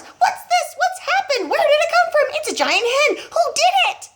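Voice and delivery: a father dancing over a giant hen; frantic excitement. A narrator's reading. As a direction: babble it in wild excitement. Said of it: "jumping about as though something was burning his feet".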